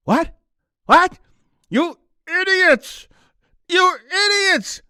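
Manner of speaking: high, slow, outraged cry; outraged owner. Acted. Democrat owner howls at the vet who killed the puppy, high outrage. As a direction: scream "You idiots" at the vet.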